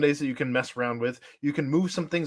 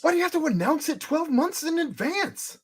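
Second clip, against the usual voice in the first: whisper yelling; 14 semitones higher than usual. Real, not acted. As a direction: yell under your breath. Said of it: "He's whisper yelling".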